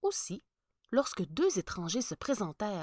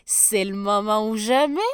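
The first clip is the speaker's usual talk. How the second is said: louder sly sneer; 2 semitones higher, 10 dB louder than usual. Acted. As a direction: gloat over the coming test. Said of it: "ricana-t-il".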